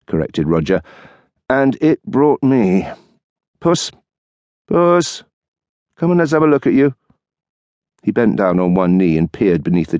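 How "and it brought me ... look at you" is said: higher, gentle calling to a cat; coaxing the kitten. Acted. Husband coaxing kitten under the chair, gentle. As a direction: coax the scared kitten out.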